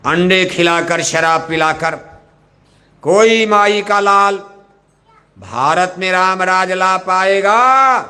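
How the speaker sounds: high, loud and slow rallying cry; a preacher shouting a challenge to the whole hall; loud slogan shout. Shown as a demonstration. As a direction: call it out with full force for the crowd. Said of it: "इतना जोर से बोलना कि machine आपकी आवाज पकड़ ले".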